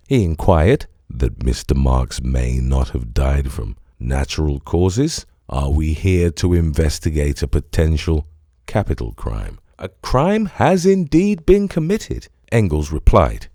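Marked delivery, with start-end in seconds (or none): 1.1-9.6 lower, dry German-free detective voice; a lower, probing Holmes; Holmes's voice. A narrator's reading. 9.8-12.3 put-on light German accent. A narrator's reading. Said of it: "with a German accent".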